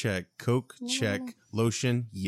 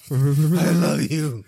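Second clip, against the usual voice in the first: lip quiver crying; 4 semitones higher, 8 dB louder, much slower than usual. Acted. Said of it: "do the lower lip quiver".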